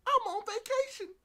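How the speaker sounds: loud, very high mock announcement; carefree vacationer imitation. Doki sipping mojitos at the beach, loud high mock-carefree voice. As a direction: sing out the excuse in falsetto.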